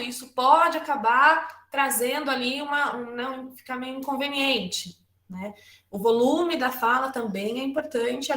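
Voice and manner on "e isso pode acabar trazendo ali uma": normal talking voice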